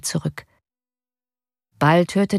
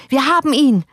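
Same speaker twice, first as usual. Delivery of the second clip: high, loud triumphant cry; shouted again; 8 semitones higher, 4 dB louder than usual. A narrator's reading. Surgeon Teikela to the crowd, gloating shout. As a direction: keep yelling it in ugly glee. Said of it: "schrie er".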